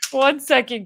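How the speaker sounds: laughing through the words; giggling while she talks. Real, not acted. Laughing at her own soreness.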